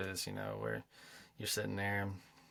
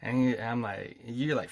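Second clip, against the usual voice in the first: ordinary talk, nothing marked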